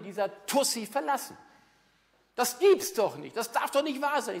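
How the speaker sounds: high, loud outraged rant from a jilted wife; shrill it out like a betrayed wife. Retold or imitated. Jilted wife ranting to her coach, loud and outraged.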